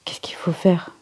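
stifled, whispered question; hushed and choked; choking back laughter. A narrator's reading. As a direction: whisper as if strangling a giggle. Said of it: "Michael dit en s'étouffant".

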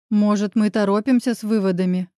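ordinary delivery

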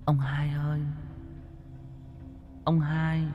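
high, soft whispered calling; ghostly whisper. Acted. Ghost in the bamboo softly calling old Hai's name. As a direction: lure him with an eerie voice.

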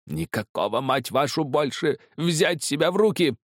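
high, enraged outburst, building; furious roar. A narrator's reading. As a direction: roar back, rising in fury. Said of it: "взревел Кестер". (яростный рёв с нарастанием)

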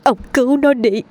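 put-on mother begging her husband to save their child; pleading. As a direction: plead desperately for your child. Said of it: "cất lời như van vỉ".

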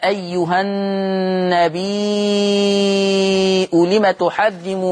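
slow chanted recitation with the ي of النبي held long; teacher chanting the verse to show the six-count elongation; Quranic recitation. Shown as a demonstration. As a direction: recite أيها النبي slowly, stretching the ي of النبي. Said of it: "فتقرأ".

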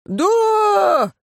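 crowd yell. A narrator's reading. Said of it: "неуверенно завопило несколько глоток".

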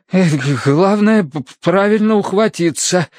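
high, halting instruction; shivering stammer. A narrator's reading. Terence explains how to climb aboard, shivering stammer. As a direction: stutter the advice through chills.

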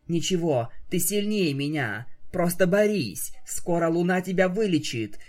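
plain reading voice

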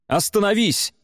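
high-pitched urgent cry; shouted command. A narrator's reading. Bjarki halts his brother heading for the cart, crying out. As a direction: cry out to halt someone. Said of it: "крикнул Бьярки".

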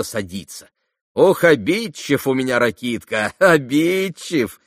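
оживлённо, громко поддразнивает; подтрунивай над обидчивым приятелем; весёлая насмешка. Acted. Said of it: "засмеялась Грушенька". (игриво, с лёгкой насмешкой)

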